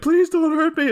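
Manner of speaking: high-pitched mock terror; petrified plea. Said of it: "are petrified of him".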